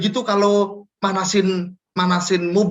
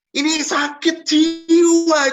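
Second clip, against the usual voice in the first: much higher, louder, slow disbelief; exasperated; 13 semitones higher, 5 dB louder, much slower than usual. Acted. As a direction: drag it out as if you can't believe it. Exasperated at lazy employee. (tak percaya, lambat dan keras)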